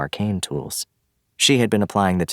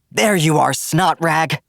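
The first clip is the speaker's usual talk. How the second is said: high, loud hoarse cry; 12 semitones higher, 6 dB louder, slower than usual. Acted. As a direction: yell it raw and hoarse. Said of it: "A hoarse voice".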